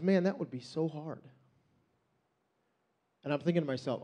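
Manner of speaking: usual speaking voice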